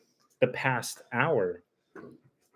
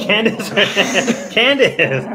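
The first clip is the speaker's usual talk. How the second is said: louder, higher call of Candace; calling out laughing; 8 semitones higher, 8 dB louder, slower than usual. Real, not acted. Streamer calling her friend Candace into view, loud.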